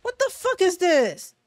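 ordinary delivery

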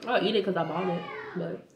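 ordinary delivery

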